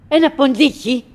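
ordinary talk, nothing marked

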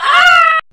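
high, loud laughing shriek. Real, not acted. Laughing shout.

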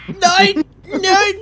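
high, loud despairing no; dismayed cry. Real, not acted. Gamer firing into the dead zone, loud no. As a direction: wail out the no.

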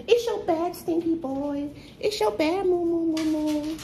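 very high cooing voice; high, silly, affectionate babble. Real, not acted. Cooing baby talk to pet Manny.

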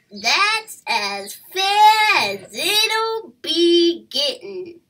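a cocky bandit setting the terms; loud boastful pirate voice; pirate raccoon Mosley. Acted. Mosley's voice in story.

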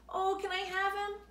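high hopeful asking; pleading girl. A narrator's reading. Laura asking for Rooney, high and hopeful. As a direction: plead for the puppy.

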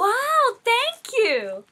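loud, high thrilled thanks; excited. Real, not acted. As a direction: burst out with thanks. Thrilled by the gold gift.

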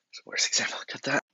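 soft unvoiced note; whispered aside. Telling the editor to cut it, whispered. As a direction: hiss it off-camera.

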